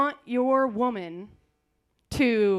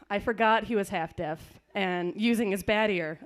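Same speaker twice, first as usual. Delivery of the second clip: ordinary delivery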